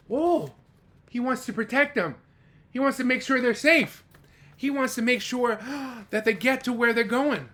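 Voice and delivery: thrilled for the ducks; excited. Acted. Excited reader explains to children.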